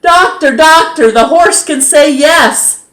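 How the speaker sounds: high, loud excited call across the yard; wife runs to the house calling for Doctor, high and loud; calling out excitedly. A narrator's reading. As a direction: call out for him, thrilled. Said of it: "ran back to the house calling".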